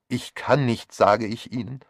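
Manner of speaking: rising almost to scream. A narrator's reading. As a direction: push it up towards a cry. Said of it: "Ihre Stimme stieg fast zu einem Schrei an". (fast schreiend, verzweifelt)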